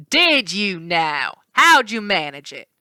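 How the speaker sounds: slow, drawn-out mocking rancher; slow smug cowherd; sly villain. A narrator's reading. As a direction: taunt him lazily.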